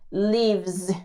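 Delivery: slow "lives" with a long buzzing Z; slow demo of "lives" with Z; buzzed Z. Shown as a demonstration. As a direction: draw out the Z in "lives". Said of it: "como una Z".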